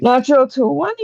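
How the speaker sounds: gasping, high exclamation; real excitement. Real, not acted. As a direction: cry out the lucky roll in delight. Player rolls the best result.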